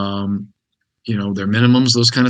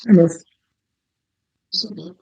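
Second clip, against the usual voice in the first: dog voice; 7 semitones higher than usual. Real, not acted. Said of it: "It's my dog voice".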